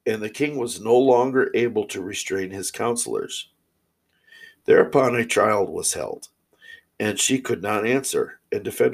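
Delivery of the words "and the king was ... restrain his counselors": ordinary delivery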